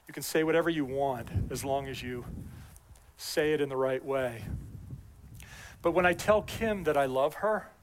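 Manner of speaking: normal talking voice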